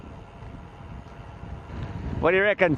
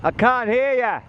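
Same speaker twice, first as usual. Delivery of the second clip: raised, high-pitched call; 7 semitones higher than usual. Real, not acted. Rider can't hear what he's saying, calling out to him. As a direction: call it back over a distance.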